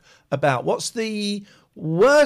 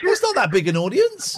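higher-pitched, insistent reassurance; insistent repeat; 9 semitones higher than usual. Real, not acted. Repeats it after caller's shocked reaction.

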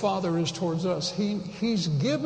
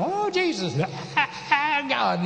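lightly imitated ecstatic cry, higher; "Oh, Jesus" pitched up in a playful mock shout; mock Pentecostal yell; 7 semitones higher than usual. Pastor mimicking Pentecostals yelling to God, mock shout. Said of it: "they're screaming".

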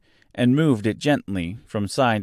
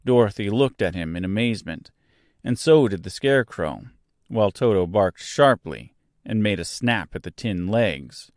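ordinary delivery, nothing marked

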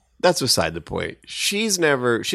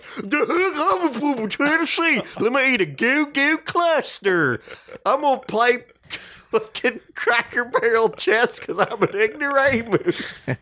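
mock Tennessee accent; 10 semitones higher than usual. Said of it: "come back here and be like".

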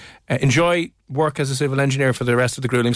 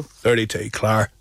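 lower, put-on Antrim old man; old man's Antrim accent; 4 semitones lower, slower than usual. Host answering who said thirty-two, lower mock Antrim accent. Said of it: "put on an old man's voice".

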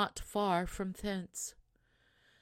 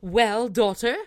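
put-on loud, eager call to the returning girl; cried out; 4 semitones higher, 11 dB louder than usual. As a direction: call out hoping for diamonds. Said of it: "she cried out".

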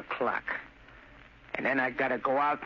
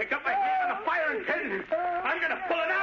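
very high, wild warning; frenzied threat; 14 semitones higher than usual. Acted. Son about to pull grenade pin, frenzied. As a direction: voice shooting up in agitation.

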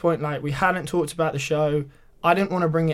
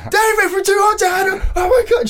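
very high, loud fan shriek; excited shout; 9 dB louder than usual. Retold or imitated. Texan woman recognising him from the show, excited shout. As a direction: yell it far across a street. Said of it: "literally just shouts".

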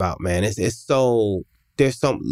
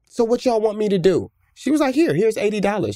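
exasperated demand; 6 semitones higher than usual. Retold or imitated. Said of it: "she's like".

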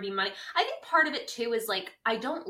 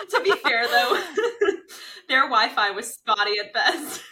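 laughing; 2 semitones higher, 8 dB louder than usual. Real, not acted. Laughing while conceding point.

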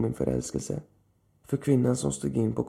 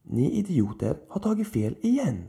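higher, scornful outburst; angry exclamation; 6 semitones higher than usual. A narrator's reading. Lilith angrily berates the old man's office. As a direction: scold the idiots. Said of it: "utbrast Lilith ilsket".

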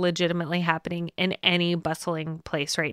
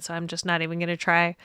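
ordinary talk

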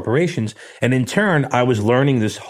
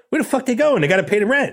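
much higher, incredulous mock outburst; half-laughing mock disbelief; retold disbelief; 13 semitones higher, faster than usual. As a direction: replay your own shock, pitched way up. Retold shock at tenants leaving.

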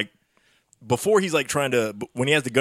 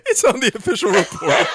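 laughing; 9 semitones higher than usual. Real, not acted. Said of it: "laughing at cop notes".

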